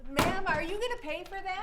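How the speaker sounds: high nasal-free clerk voice; a fed-up shop clerk; cashier voice. Acted. Cashier voice in skit.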